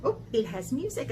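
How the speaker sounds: ordinary talk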